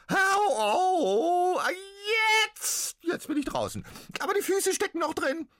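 very high, loud squeaky ghost crying ow; ouching little ghost. Acted. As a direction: whimper it as the tiny ghost squeezing out. Ghost pops out, in pain.

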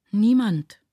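lightly acted flustered child, no real stutter; stammering child. A narrator's reading. Child answering who looks after him, lightly stammering. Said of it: "stotterte das Kind".

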